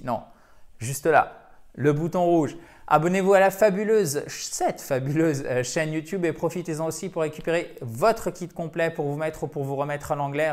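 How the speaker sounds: ordinary talk, nothing marked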